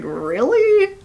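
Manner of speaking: high, surprised really; mock surprise. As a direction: squeak it up in disbelief. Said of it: "he's like".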